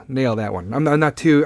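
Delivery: normal talking voice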